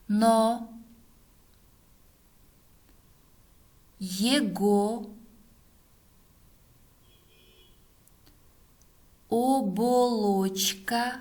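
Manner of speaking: word by word with long pauses; dictating. Shown as a demonstration. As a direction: dictate slowly, one word at a time. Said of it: "Следующее предложение". (диктует по словам)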